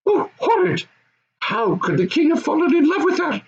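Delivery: put-on high goblin child voice, squealing in disgust. Goblin child shocked by the Queen's toes, high disgusted voice. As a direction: recoil like a horrified little goblin.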